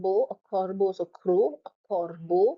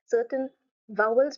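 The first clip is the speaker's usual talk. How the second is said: ordinary talk, nothing marked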